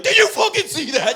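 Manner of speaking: high, loud amazed shout; giddy shout of amazement; excited. Retold or imitated. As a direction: yell it at nobody, thrilled. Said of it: "and you're like".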